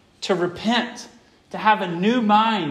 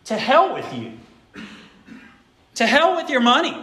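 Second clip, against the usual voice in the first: higher, louder heated rebuke; Peter's fiery curse; 8 semitones higher, 4 dB louder than usual. Retold or imitated. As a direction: blast it out like an outraged apostle. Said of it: "is what Peter's saying here".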